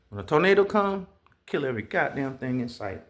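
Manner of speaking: ordinary talk, nothing marked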